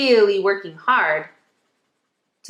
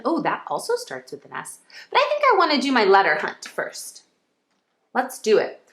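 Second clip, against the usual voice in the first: ordinary delivery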